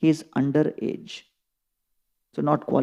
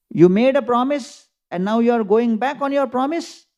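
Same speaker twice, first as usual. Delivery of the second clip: sharp indignant rebuke, higher and louder; very angry; 7 semitones higher, 4 dB louder than usual. Retold or imitated. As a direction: confront him over the broken promise. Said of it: "becomes very angry".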